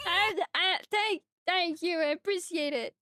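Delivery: high, crying, halting gratitude; tearful thanks. Real, not acted. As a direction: stammer thanks through real tears. Thanks while crying over gifts.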